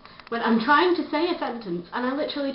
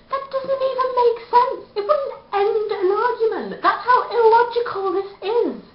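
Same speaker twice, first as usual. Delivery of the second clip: pitched way up, louder in exasperation; exasperated; 10 semitones higher, 4 dB louder than usual. Real, not acted. Ranting to YouTube viewers, exasperated and high. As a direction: throw your hands up, voice soaring higher. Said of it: "exasperated rant".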